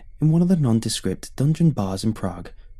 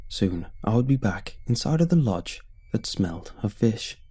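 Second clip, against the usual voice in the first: ordinary delivery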